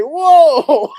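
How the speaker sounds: loud, high, laughing shock; stunned whoa. Retold or imitated. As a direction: blurt it out high, breaking into laughter. Retold shock at being hung up on.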